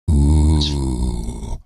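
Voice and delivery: low, loud ominous ooh; ominous mock ooh. Mocking Google's empty seat.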